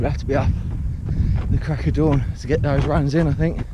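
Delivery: slightly breathless, talking on the move; loud and puffing mid-stride; while running. Real, not acted. Talking mid-run on trail.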